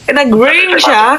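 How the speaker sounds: ordinary talk, nothing marked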